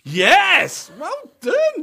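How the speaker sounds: loud, high celebratory yell; triumphant shout. Real, not acted. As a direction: shout it like a winning goal. Celebrates her correct answer.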